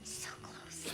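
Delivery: breathy teasing whisper, barely voiced. Real, not acted. Host whispering to the player over a wrong answer, hushed. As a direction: hush it out like a secret tease.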